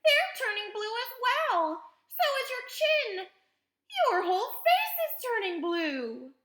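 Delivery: high, loud, panicked screaming; alarmed mother screaming; screamed. A narrator's reading. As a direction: keep screaming, line after line. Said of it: "screamed Missus Beauregard".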